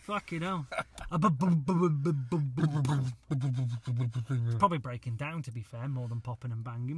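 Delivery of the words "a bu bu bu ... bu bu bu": engine pop noises. Retold or imitated. Imitating engine popping sound.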